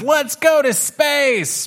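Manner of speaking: high, drawn-out rallying cry; high-pitched, slow, exuberant shout-out; excited kitten. Acted. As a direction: cheer for launch in a kitten voice. Kitten rallies the other, story.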